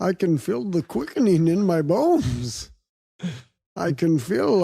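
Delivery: put-on low, dramatic Egyptian accent. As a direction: intone it like a mystical Egyptian. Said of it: "I love your Egyptian accent".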